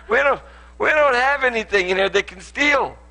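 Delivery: high-pitched, hammed-up protest; mock exasperated reply. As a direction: act out insisting there is nothing to take. Retells own exasperated reply about theft.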